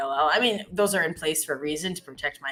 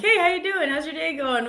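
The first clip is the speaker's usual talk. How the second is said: very high, bubbly small talk; chirpy friendly; 12 semitones higher, 4 dB louder than usual. Retold or imitated. Canadian chatting up a barista while ordering coffee, bubbly. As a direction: gush at the barista.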